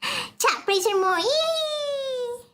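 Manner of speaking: gasp, then a squealed high yay; delighted at chat praise; excited squeal. Real, not acted.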